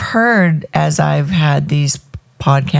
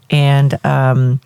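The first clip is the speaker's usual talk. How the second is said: ordinary delivery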